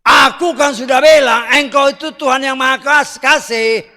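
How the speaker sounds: loud, high-pitched recital of the prophet's words; loud preaching quote. Acted. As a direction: proclaim it at full preaching force. Preacher voicing a prophet's complaint. (kutipan nabi dengan suara keras dan tinggi)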